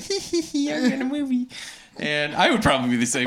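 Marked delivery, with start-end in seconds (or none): 0.0-1.5 high, soft mock giggly voice; giddy happy actress. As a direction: giggle through it in a high put-on voice. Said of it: "she looks kinda happy".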